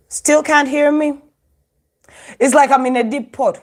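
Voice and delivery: ordinary talk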